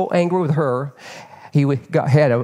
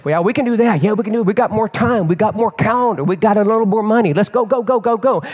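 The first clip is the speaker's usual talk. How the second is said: higher, run-on eager chatter; mock eagerness; 5 semitones higher than usual. Busy church taking on more and more, mock eager rush. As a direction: rattle it off like someone piling on commitments.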